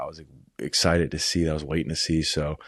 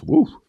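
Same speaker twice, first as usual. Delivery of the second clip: high, loud whoop of delight; excited whoop; 9 dB louder than usual. Real, not acted. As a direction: let out a sharp joyful woo. Reacting to spicy nuggets.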